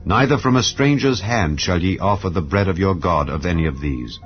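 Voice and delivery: ordinary delivery, nothing marked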